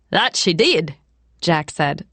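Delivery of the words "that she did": failed Irish lilt sounding Jamaican; hammy fake Irish; bad Irish accent. A narrator's reading. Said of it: "attempting to imitate her Irish lilt".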